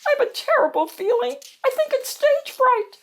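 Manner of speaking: very high-pitched, anxious character voice; nervous Pearl. Acted. Pearl confiding stage fright to the worry detective, nervous high voice. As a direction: squeal out the worry in a tiny high voice.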